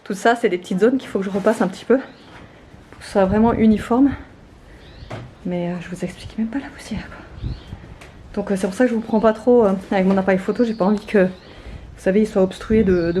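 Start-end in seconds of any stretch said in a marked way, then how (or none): 5.5-7.5 whispering. Real, not acted. Aside about the dust.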